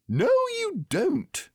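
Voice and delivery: loud, very high drawn-out retort; squeaky contradiction. A narrator's reading. Friends squabbling over the present, squeaky retort.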